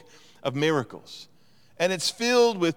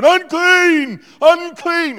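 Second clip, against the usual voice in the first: very high, very loud mock scream; acted scream; 14 semitones higher, 11 dB louder, much slower than usual. Imagining being sick at Costco, acted scream. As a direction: scream out a public warning, full voice. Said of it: "you start screaming out".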